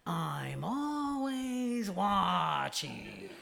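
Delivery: slow, drawn-out crotchety boss voice; a pastor imitates his daughter's Roz impression; imitating Roz. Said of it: "likes to impersonate Roz".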